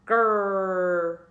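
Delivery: long growling "Grrr" for the G R blend; teacher demoing the gr sound; growled grrr. Shown as a demonstration. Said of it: "I think I need a G and an R".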